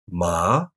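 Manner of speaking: MA starting mid and rising; rising second tone. Shown as a demonstration. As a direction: lift "Ma" upward from mid-range. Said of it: "starts in the mid-range and rises".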